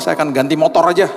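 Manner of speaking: normal talking voice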